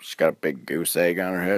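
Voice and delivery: usual speaking voice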